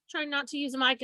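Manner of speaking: ordinary delivery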